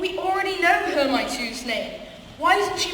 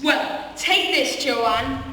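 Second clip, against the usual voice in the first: usual speaking voice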